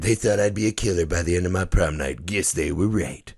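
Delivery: low, subdued opening narration; movie voiceover. Acted. Main kid narrates the opening scene, low voiceover. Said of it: "the voiceover of the main kid".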